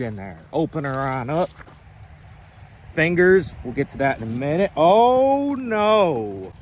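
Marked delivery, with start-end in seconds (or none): 4.8-6.5 surprised. Real, not acted. Discovers missing stringer.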